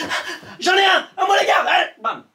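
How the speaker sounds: high panicked alarm call; breathless panicked shout. Acted. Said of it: "tu gesticules un peu, tu essouffles".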